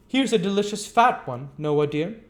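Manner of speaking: put-on high, sweet coaxing girl; coaxing Charlotte. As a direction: offer it up sweetly to him.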